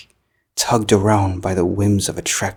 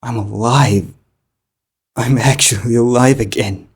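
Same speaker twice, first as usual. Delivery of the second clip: elated; 2 semitones higher, 5 dB louder than usual. Acted. Body returned, elated.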